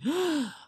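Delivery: startled gasp. Retold or imitated. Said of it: "and I went".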